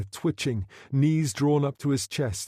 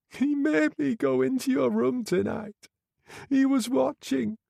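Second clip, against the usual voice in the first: put-on a high girlish voice, tearful and broken; sobbing young woman; 13 semitones higher than usual. A narrator's reading. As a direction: play the shamed girl near tears, pitched high. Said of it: "She could hardly speak for sobbing".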